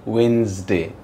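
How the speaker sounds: "वेडनेसडे" said as WENZ-day, D silent; silent-D pronunciation. Shown as a demonstration. Teacher corrects the mistake, "वेडनेसडे" as wenz-day. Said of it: "डी बिल्कुल silent होता है".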